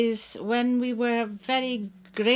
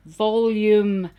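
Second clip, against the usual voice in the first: louder; 8 dB louder than usual. Acted. Repeated word, louder.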